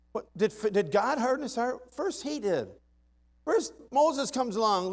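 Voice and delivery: high, loud, building preacher's cry; rising to a pulpit-pounding climax; fiery preaching. Acted. Preacher to the congregation, fiery rising preaching.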